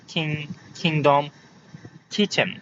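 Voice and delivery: "কিং", "কিংডম", "কিচেন" with puffed k; separated model words, airy k as in "কিং"; aspirated K. Shown as a demonstration. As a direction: drill "কিংডম" and "কিচেন" with kh onsets. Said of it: "সবগুলোই তোমরা খ উচ্চারণ করবে".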